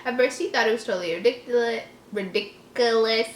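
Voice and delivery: ordinary delivery, nothing marked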